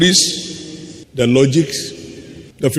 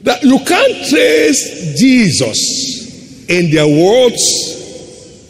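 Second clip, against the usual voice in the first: high, slow, drawn-out cry; exclaiming in reproach; 9 semitones higher, 4 dB louder, much slower than usual. Real, not acted. Preacher decrying worldly believers, high drawn-out exclamation. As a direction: call it out high, stretching each word.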